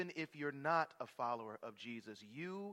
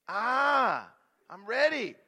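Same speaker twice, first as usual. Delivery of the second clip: loud, higher, ready for battle; cocky strongman shout; 9 semitones higher, 15 dB louder, slower than usual. Acted. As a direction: shout it like you can't lose. Samson voiced ready to fight.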